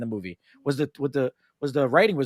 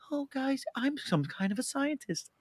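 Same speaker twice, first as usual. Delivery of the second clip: much higher, softer, slow put-on voice; mock Norman; 11 semitones higher, 10 dB quieter, slower than usual. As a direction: play the timid scientist, sweetly. Imitating Norman in the back.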